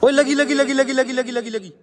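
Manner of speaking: high-pitched excited chant, fading at the end; real rapid-fire "caught it" excitement; excited outcry. Real, not acted. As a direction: cry out as you catch them red-handed. Spots the hit on camera footage.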